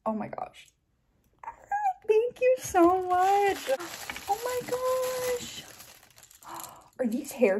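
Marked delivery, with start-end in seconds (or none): 2.1-5.6 high, slow, gushing thanks; thrilled gushing. Real, not acted. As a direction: pour out delighted thanks. Thanking fan for gifts.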